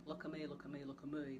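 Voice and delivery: quiet Australian mum nagging three times; Australian mum repeating it softly; Australian accent. Retold or imitated. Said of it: "That's how she actually said it".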